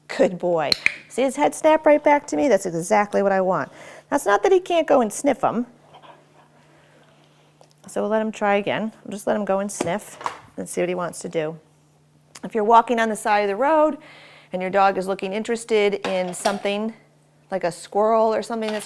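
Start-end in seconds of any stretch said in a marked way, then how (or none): none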